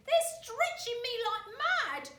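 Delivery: high, loud squeal of the wife; crying out as Mrs Twit. A narrator's reading. Wife squealing as balloons pull her upwards. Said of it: "cried Missus Twit".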